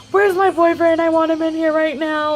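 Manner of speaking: high, tearful demand, imitated; retold crying demand. Patient calling for her boyfriend during surgery prep, imitating tearful pleading. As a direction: beg for him through mock tears. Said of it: "Like, I'm crying".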